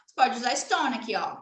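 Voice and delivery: ordinary talk, nothing marked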